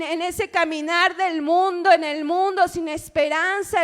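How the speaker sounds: ordinary talk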